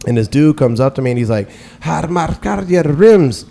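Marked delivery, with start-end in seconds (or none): 1.8-3.5 mock Armenian accent, slow and higher; imitating Armenian accent. Imitating the Armenian gas station guy's accent, mock accented. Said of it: "he immediately went into an urban accent".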